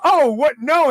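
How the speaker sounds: retold "Oh, what? No." pitched high and loud; mock shocked refusal. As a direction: recoil in mock alarm at the door.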